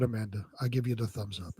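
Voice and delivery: usual speaking voice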